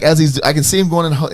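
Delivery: ordinary talk, nothing marked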